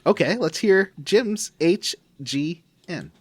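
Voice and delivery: normal talking voice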